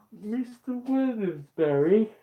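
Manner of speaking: high, loud, slow posh British call; British character. Acted. Streamer voicing Care, British and loud. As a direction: call the name out grandly in a British accent. Voiced character calling out name.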